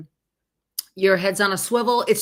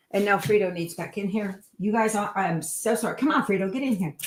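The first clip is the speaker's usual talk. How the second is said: ordinary delivery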